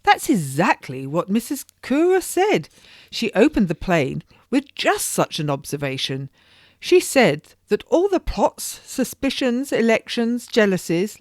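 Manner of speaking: lightly voiced lady recalling a prologue with glee; excited society lady.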